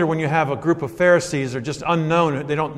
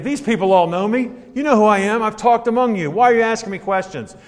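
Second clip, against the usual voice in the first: higher, louder, pressing his questioners; impassioned Jesus; 7 semitones higher, 4 dB louder than usual. Acted. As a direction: plead as if wronged by old friends. Voicing Jesus again.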